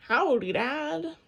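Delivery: slow, drawn-out belted greeting. A narrator's reading. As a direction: belt it out slowly across the kitchen. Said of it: "Annie belted out".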